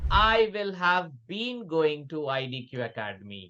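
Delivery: "I will have been going to I D Q Academy" said very slowly, word by word; slow dictation. Shown as a demonstration. Teacher dictating to students, slow and careful. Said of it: "naturally बोलना है".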